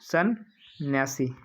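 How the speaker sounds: सन्यासी stretched very slowly, syllable by syllable; teacher sounds out सन्यासी slowly for the class; slow spelling demo. Shown as a demonstration. Teacher spelling सन्यासी for students, drawn out slowly. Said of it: "ऐसे लिखा जाता है".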